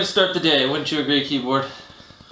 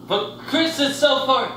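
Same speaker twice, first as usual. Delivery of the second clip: whiny; 10 semitones higher, slower than usual. Acted. Whining about the remote.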